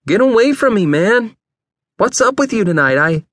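high, agitated protest; sour outburst. A narrator's reading. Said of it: "Vern looked at him sourly".

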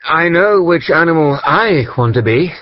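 breathless, running after her up the stairs; panting. Acted. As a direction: painter chasing the girl upstairs, panting and calling out. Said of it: "I panted".

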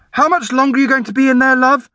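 high, quick motherly call; mum's voice. A narrator's reading. Norm's mum asking through the door, high motherly call.

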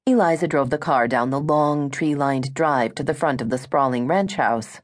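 ordinary delivery, nothing marked

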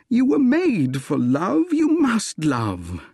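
acted passionate declaration; impassioned pleading. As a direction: draw the words out with ardour, pitch soaring. Suitor passionately pleads with Gladys.

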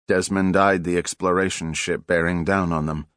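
ordinary delivery

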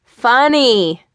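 loud, high dry call back; sarcastic call. A narrator's reading. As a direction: toss a sarcastic reply after him. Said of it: "Mike called back".

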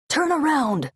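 high, urgent order; shouted command. Acted. Guard captain ordering bearers to retreat, high urgent shout. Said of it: "her guard captain shouted".

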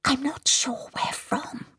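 high, slow whisper of a troubled medium; uneasy whisper. Acted. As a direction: whisper it as if lost in a trance. Medium in trance senses fire.

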